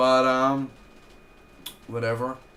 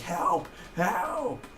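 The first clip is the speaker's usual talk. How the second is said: higher mock shout for help; mock cry for help; 8 semitones higher than usual. Reviewer imitating Tom Cavanaugh crying for help, higher pitched. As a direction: call out as if in danger. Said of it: "I just love Tom Cavanaugh's acting".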